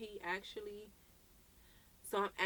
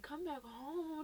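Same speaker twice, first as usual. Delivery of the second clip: slow, higher, scornful echo; mocking repeat; 8 semitones higher, 3 dB quieter, much slower than usual. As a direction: drag it out mockingly. Mocking his message.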